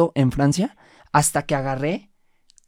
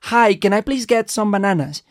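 slow, bright tourist English, louder and higher; clueless tourist; 5 semitones higher, 6 dB louder, slower than usual. Retold or imitated. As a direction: play the oblivious foreigner ordering in English. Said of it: "volteo a ver a la señora y le digo".

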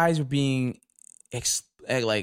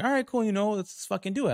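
much higher, eager retelling; a thrilled yes to the new job; pitched-up mock delight at the offer; excited; 8 semitones higher than usual. Accepting the promised raise.

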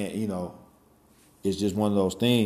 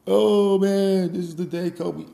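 mock mourner; 11 semitones higher, 6 dB louder, slower than usual. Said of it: "everybody else was like".

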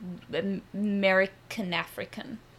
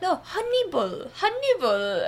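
imitating Minu's accent; 9 semitones higher, 3 dB louder than usual. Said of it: "and he's like".

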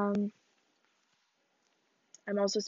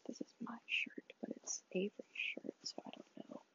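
whispered aside; hushed confiding whisper; 16 dB quieter than usual. Real, not acted. Whispered aside about shirt.

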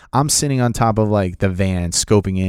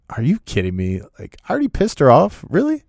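disbelieving panic; 7 semitones higher than usual. Retold or imitated. Panics after a mock rebuke.

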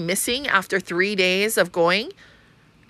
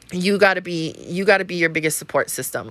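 ordinary talk

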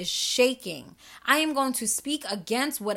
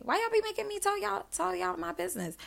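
high teasing whine; 8 semitones higher, 7 dB quieter, faster than usual. Real, not acted. Teasing complaint to listeners.

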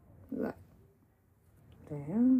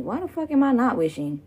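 normal talking voice

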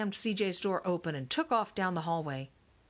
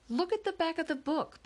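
put-on high-pitched, insistent child; urgent, high plea to be believed; 11 semitones higher than usual. A narrator's reading. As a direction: insist in a high little-boy voice. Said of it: "Patrick insisted".

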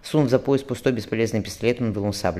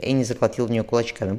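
ordinary delivery

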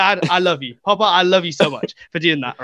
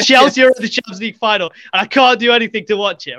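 exasperated retold complaint, pitched up; mock-fuming protest, a little loud; 5 semitones higher, 4 dB louder than usual. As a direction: act out a frustrated moan. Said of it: "like, fuming".

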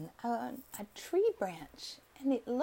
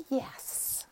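tiny whispered yes. A narrator's reading. Kitten whispers yes to the lion cub. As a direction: barely voice it, a shy kitten.

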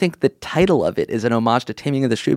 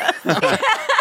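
shrill burst of laughter; high squealing laugh. Real, not acted.

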